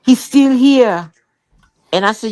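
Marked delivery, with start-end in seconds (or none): 0.0-1.1 imitating jealous husband. To her friends, mimicking her husband's jealousy, high and whiny. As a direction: whine about the baby, hammed up. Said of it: "he would just go".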